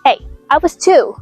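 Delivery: usual speaking voice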